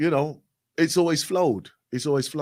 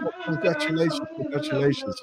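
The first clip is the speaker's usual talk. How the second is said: high-pitched, teasing congratulations; retold praise, pitched up in mock delight; 12 semitones higher, 4 dB quieter, faster than usual. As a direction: lightly make fun of a tiny achievement. Mock praise for a mere coffee.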